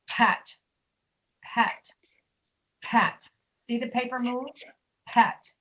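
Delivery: "pat" with a puff of air on the p; aspirated p. Shown as a demonstration. Teacher making the paper move with "pat". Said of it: "I showed you with the letter P".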